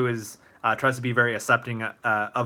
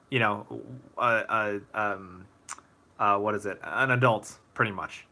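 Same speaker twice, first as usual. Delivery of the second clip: ordinary talk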